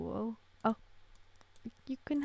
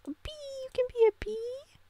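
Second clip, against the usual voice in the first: excited; 12 semitones higher than usual. Real, not acted. Delighted baby costume.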